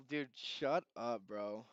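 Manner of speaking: words with tongue out; tongue sticking out. Pretend. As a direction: say it with tongue stuck out. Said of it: "stick your tongue out and say".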